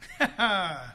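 short low laugh; brief laugh after a big line. Real, not acted. Preacher amused by his own re-enactment, short laugh.

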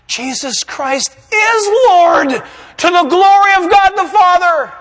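slow, loud, high-pitched proclamation; earnest, ringing confession; meaning it. Shown as a demonstration. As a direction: declare it slowly with full conviction. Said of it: "He's gonna mean it".

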